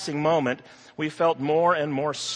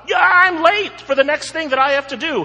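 high, loud panicked shriek; 12 semitones higher, 10 dB louder, faster than usual. Acted. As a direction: shriek it in a frantic rush. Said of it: "he shrieked out loud".

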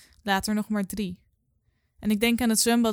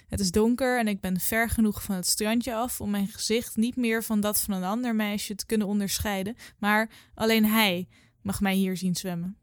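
plain reading voice

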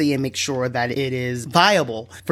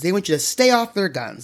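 ordinary delivery

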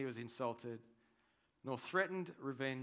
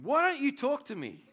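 higher, louder, demanding with a laugh; demanding Pilate; 13 semitones higher, 11 dB louder than usual. Retold or imitated. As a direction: press him hard for an answer. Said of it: "Pilate demanded".

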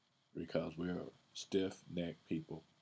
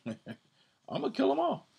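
squeaky high, louder, laughing through it; mock wrathful God; 5 dB louder than usual. The Most High threatening his people, hammed-up high laughing voice. Paraphrasing the Most High's anger.

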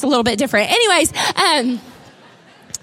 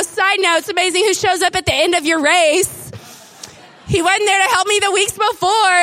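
ordinary talk

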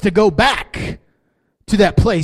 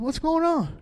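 gentle question; 7 semitones higher, 7 dB quieter, slower than usual. Retold or imitated. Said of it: "I said".

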